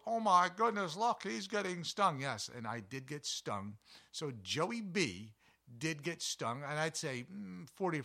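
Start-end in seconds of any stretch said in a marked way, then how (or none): 0.1-2.2 much higher and louder, startled; a shocked bystander sounding the alarm; alarmed onlooker. Retold or imitated.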